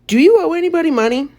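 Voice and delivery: put-on high, fast blunt old doctor; old woman voice. A narrator's reading. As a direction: ask it briskly like an old lady.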